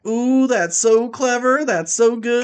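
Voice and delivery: mock the writer gloating over a bad pun; smug self-praise. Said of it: "the writer who thought of that was like".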